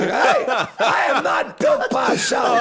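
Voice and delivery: loud, high, drawn-out denial; shouted protest. Retold or imitated. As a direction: bellow an indignant correction, slowly. Acted protest at mistaken identity.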